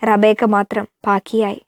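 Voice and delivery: ordinary delivery, nothing marked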